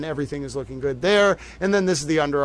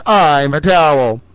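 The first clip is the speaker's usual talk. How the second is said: deadpan towel quote; 2 semitones lower, 7 dB louder, slower than usual. Retold or imitated. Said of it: "And it says".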